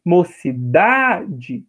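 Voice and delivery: "Mocidade" drawn out slowly with DA stressed. Shown as a demonstration. Teacher showing the strong syllable, "Mocidade" slow with stressed DA. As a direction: lean long on the DA of "Mocidade". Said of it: "a sílaba forte é o da".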